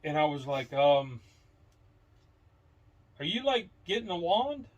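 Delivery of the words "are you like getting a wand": pitched-up, imitating his own worried question; mock alarmed higher query; nervous question. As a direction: ask it higher as if alarmed by the wand.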